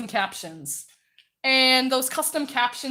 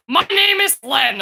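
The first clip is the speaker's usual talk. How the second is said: coarse, funny character voice, shouted louder; Len voice; 5 semitones higher, 10 dB louder than usual. Shown as a demonstration. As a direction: belt out the goofy coarse Len voice. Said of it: "this was that voice!".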